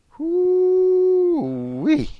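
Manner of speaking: high, long amazed "hoo-wee"; amazed whoop. Real, not acted. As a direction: whoop in disbelief. Reaction to shocking remark.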